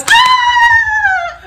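high, loud mock scream; excited scream. Boyfriend presenting the gift, excited scream. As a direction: imitate his thrilled, high-pitched yell. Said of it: "he's getting excited".

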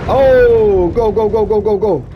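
loud high rapid go go go; frantic urging. Real, not acted. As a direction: yell at them to hurry. Chase in game, urging.

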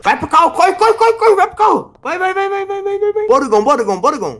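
high, frantic urging; urgent shouting. Real, not acted. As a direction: yell at him to run, fast. Urging partner to run.

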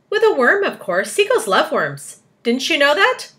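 high, loud and keen; eager exclaiming. A narrator's reading. James revealing his bait idea, eager. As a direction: burst out with the obvious answer.

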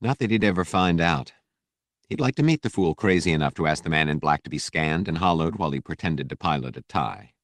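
ordinary delivery, nothing marked

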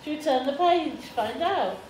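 ordinary talk

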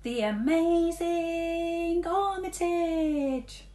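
slow, high, grand announcement; showman announcer. A narrator's reading. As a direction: announce it like a circus ringmaster. Girl announces rat act.

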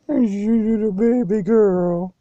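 put-on high cooing baby talk; girlfriend's baby talk. Mimicking girlfriend cooing at the dog, high baby talk. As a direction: coo like she does to the dog. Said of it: "That's how she talks".

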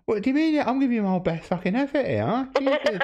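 high-pitched mock outrage; mock indignation. Caller chiding a giggling partner, mock indignant.

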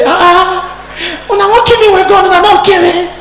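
squeaky, laughing disbelief; old woman laughing at the robbers who didn't kill her; high laughing relief. Retold or imitated.

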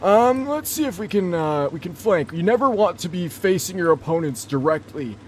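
put-on high, strained old man voice, loud. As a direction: put on a wobbly old gamer voice. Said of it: "I'll still play like Old Man Blitz".

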